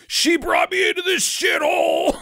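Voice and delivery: high, ranting villain outburst; mock outraged yell. Movie Santa raging about his mom, mock high shout. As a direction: cry it out high in hammed-up grievance.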